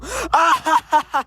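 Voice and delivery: loud high-pitched cackle; laughter. Real, not acted. Vlogger reacting to the silly one-word answer, cackling.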